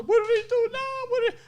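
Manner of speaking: be the fed-up mom; exasperated mother. Retold or imitated. Mother seeing the bag of money, in a high exasperated voice.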